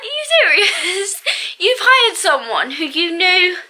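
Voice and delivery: incredulous laugh at the boss; laughing disbelief. Real, not acted. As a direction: laugh in disbelief as you ask. Disbelief at boss's hiring choice.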